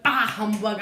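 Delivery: hammed-up miser's dismissal; mock grumpy outburst. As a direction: punch it out like a comic grouch. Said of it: "That's what he always says".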